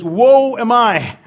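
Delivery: lament. Retold or imitated. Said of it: "He says".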